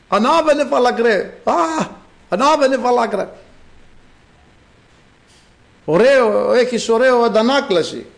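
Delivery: high-pitched acted jeering; mocking taunt. Mockers to the bald prophet Elisha, mocking taunt. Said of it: "Και γελάγανε και παίζανε".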